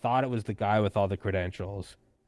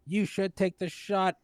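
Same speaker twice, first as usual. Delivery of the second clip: high, slow, sanctimonious voice; mocking preachy commenter; 11 semitones higher, slower than usual. Mocking pro-vaccine commenters lecturing the podcaster, high and slow. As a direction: put on a high, drawn-out holier-than-thou whine. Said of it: "people being like".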